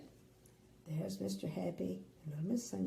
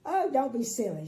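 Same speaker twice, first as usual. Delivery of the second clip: higher, loud teasing; cheerful scolding; 4 semitones higher, 7 dB louder than usual. Acted. Little Miss Sunshine teases the king, high and light. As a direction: tease him kindly. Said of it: "she replied".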